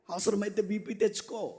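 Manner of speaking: ordinary delivery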